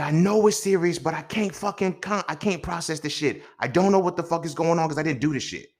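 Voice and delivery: high and loud, overwhelmed; frantic panic. Retold or imitated. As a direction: blurt it out losing control. Panicked reply to sister.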